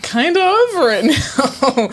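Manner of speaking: high-pitched, laughing confession; laughing admission. Real, not acted. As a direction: let it rise up into a laugh. Laughs admitting she is fed up.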